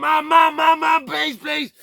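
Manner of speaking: high, loud, desperate pleading; frantic high-pitched begging scream; screaming for Mum. Retold or imitated. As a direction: re-enact a child screaming for help. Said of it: "I'm screaming".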